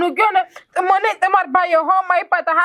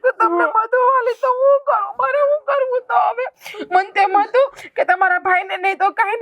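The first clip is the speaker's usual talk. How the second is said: high, crying lament in a breaking voice; tearful wail; 5 semitones higher than usual. Acted. Sister-in-law wailing at her devar, high and tearful. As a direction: sob out the hurt, pitch climbing. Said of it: "ભાભી તમે રોવો માં".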